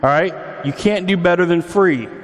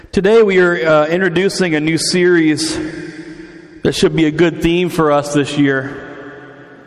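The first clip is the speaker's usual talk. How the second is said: normal talking voice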